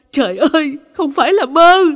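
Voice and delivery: high, loud shriek of horror; horrified scream. A narrator's reading. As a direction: cry out aghast, pitch and volume jumping. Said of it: "thì thất thanh hét lên". (thất thanh hét lên kinh hoàng)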